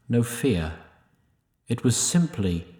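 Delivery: normal talking voice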